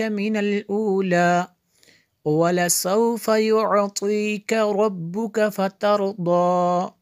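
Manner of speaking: connected, melodic Quran chant with long madds; hadr recitation. Shown as a demonstration. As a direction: sing the verses through in flowing recitation. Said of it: "Haider में देख लेते हैं". (हदर में रवाँ, सुरीली तिलावत)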